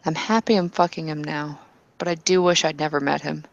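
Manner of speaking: ordinary talk, nothing marked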